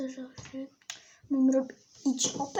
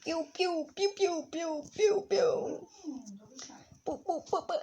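shooting noises; 4 semitones higher than usual. Acted. Imitating shooting sounds.

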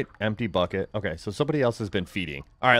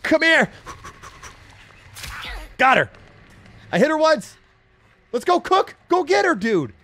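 very high, frantic game yells; excited shouting; 4 dB louder than usual. Real, not acted. Gamer urging Cook after the victim, excited yelling. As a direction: urge the teammate on at full pitch. Landing hits, urging teammate.

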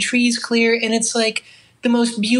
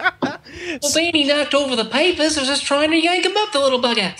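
higher, hammed-up Australian voice; Australian accent; 4 semitones higher than usual. Guest spoofing The Shining to make host laugh, Australian accent. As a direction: make excuses as a comic Australian. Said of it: "would not work in an Australian accent".

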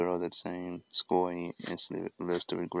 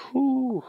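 high whistling whew; 5 dB louder than usual. Real, not acted. Reactor reacts to the clip.

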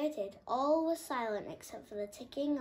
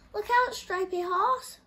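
high, loud alarmed call; flapping warning; 11 semitones higher, 11 dB louder than usual. A narrator's reading. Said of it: "flapped Muriel".

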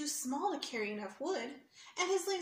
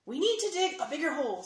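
fast, louder leprechaun urging; urgent leprechaun; 4 semitones higher, 7 dB louder, faster than usual. Acted. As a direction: rush them to dig. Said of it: "said one leprechaun".

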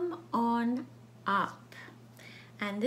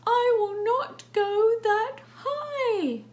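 loud, slow, high-pitched refusal; stubborn cat; 11 semitones higher, 9 dB louder, much slower than usual. Acted. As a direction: dig in and wail every word out. Cat keeps refusing in story.

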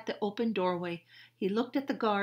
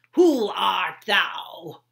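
slow stern guard; 15 dB louder, much slower than usual. Acted. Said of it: "said the one with the very red face".

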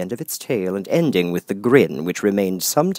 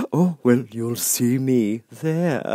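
grinning Cheshire Cat; 4 semitones higher, slower than usual. Acted. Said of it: "said the cat".

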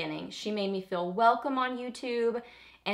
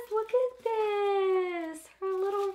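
excited squeal; 11 semitones higher, slower than usual. Real, not acted. Opening a gift from friend.

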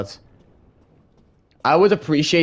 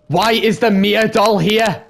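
higher, louder, slowed fearful plea; scared; 7 semitones higher, 6 dB louder, slower than usual. Real, not acted. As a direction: fear boiling over on the repeat. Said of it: "I'm scared".

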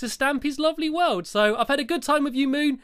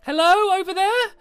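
calling out; 8 semitones higher, 4 dB louder than usual. Real, not acted. Calling out to aliens on moon.

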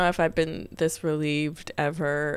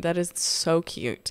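normal talking voice

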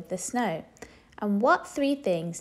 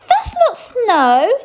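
high, loud, slow outraged; indignant princess; 13 semitones higher, 12 dB louder, slower than usual. A narrator's reading. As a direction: protest indignantly. Princess hit with mud.

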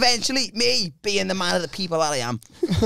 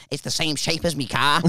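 lower, slightly softer put-on voice; insistent friend's voice; 6 semitones lower, 4 dB quieter than usual. Friend pointing at the patch of grass, low insistent voice. Voices Chris, then retorts as himself.

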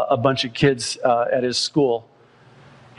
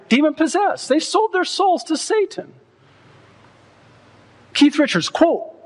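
high, fervent preacher's cry; preaching at full force. Acted. Preacher warning the congregation about music: fervent high cry.

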